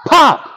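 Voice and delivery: high, sudden little pop. Acted. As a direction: pop it out like a cork. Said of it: "everyone say".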